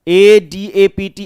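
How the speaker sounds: letters spelled slowly one by one. Shown as a demonstration. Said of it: "एक होता है".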